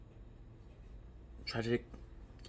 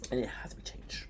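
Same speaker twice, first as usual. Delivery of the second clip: dramatic whisper; 8 dB quieter than usual. Real, not acted. Explaining Attack on Titan's world to viewers, in a whisper. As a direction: drop to a hushed whisper for weight.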